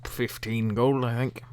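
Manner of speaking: lightly voiced boozy boatman; a touch of drunken haggler; drunk middle-aged man. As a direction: hazard a price, a little tipsy. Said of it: "drunk middle-aged man".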